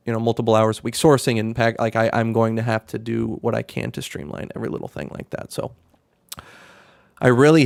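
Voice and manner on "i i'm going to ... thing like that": ordinary talk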